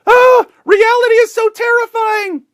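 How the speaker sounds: high shrieking mock panic; hammed-up high panicked yell; mock terrified scream. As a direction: shriek it like a scared reporter. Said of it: "They run back inside".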